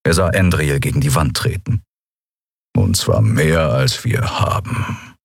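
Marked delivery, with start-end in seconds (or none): 2.8-5.2 low, slow grumble trailing away; grumbling. A narrator's reading. Crewmate adds that the debt is unpayable, low slow grumble. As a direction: drop deep and grumble the bad news. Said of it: "brummte Keru".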